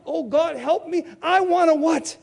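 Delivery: ordinary talk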